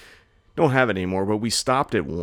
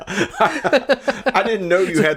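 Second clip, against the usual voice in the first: high, slow words through real laughter; laughing; 12 semitones higher, 6 dB louder, much slower than usual. Real, not acted. Laughing at her friend's Dunkin' Donuts rant. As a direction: laugh it out at the surprise.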